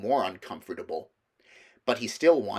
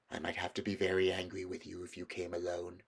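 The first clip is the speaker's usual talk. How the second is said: low, soft menacing queen; hint of menace; veiled threat; 3 semitones lower, 9 dB quieter than usual. A narrator's reading. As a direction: quietly threaten the boy.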